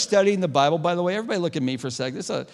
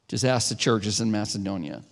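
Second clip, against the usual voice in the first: normal talking voice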